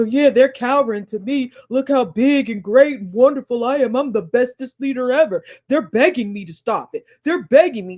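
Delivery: mock Trump, chest puffed out, bragging loudly; boastful Trump. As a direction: puff your chest out and brag. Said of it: "puff his chest out and go".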